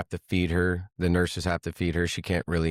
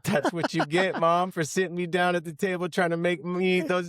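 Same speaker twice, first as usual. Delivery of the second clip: gloating; 9 semitones higher than usual. Retold or imitated. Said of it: "And I'm like".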